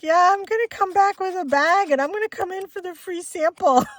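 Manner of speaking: high, loud mocking imitation; teasing son impression. Acts out son's teasing joke.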